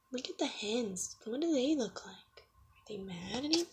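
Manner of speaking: soft whispered questions. Real, not acted. Reader quietly asking the child about the hens. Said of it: "aside to child".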